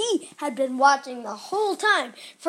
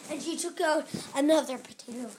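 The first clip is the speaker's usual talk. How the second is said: plain reading voice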